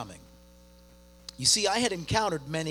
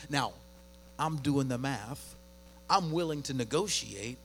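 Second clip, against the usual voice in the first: ordinary delivery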